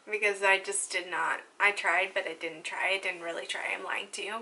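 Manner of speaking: fading into a guilty, sheepish confession; sheepish admission. Real, not acted. Admitting to viewers she never really tried, fading sheepishly.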